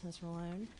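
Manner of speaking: ordinary talk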